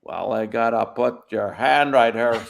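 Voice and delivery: slow cowboy drawl; John Wayne. Acted. Centurion at the cross, drawling cowboy voice. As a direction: stretch it out like an old western star. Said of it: "do John Wayne as a Roman centurion".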